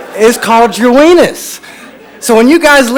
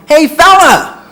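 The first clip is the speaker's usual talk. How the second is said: loud, high holler across the bar; drunk man's yell; 7 semitones higher, 5 dB louder, much slower than usual. Retold or imitated. Drunk stranger hailing the comic across the bar, yelling. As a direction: call out like a tipsy stranger. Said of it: "and he yells".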